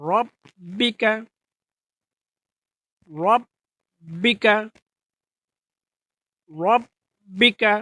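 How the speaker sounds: রব্বিকা slow, with a rounded রো sound; correct Arabic pronunciation. Shown as a demonstration. Teacher recites for listeners: রব্বিকা slow, first vowel rounded. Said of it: "জি, এভাবে".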